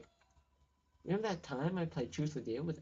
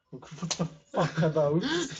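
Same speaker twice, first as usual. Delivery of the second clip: laughing disbelief; 9 dB louder, slower than usual. Real, not acted. Laughing shock at strange flowers.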